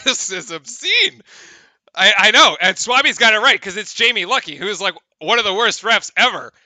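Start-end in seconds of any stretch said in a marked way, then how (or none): 0.1-1.2 slow, drawn out in disbelief; disgusted verdict. Real, not acted. Streamer condemning the referee, slow disbelief. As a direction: lean on obscene.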